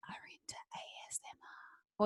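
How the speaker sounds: soft unvoiced whisper. Shown as a demonstration. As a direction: whisper to the listeners. Whispering about ASMR.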